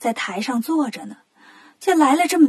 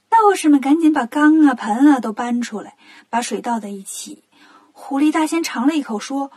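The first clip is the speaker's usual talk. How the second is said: ordinary delivery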